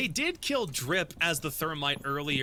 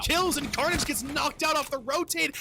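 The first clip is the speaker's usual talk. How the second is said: live play-by-play; 8 semitones higher than usual. Real, not acted.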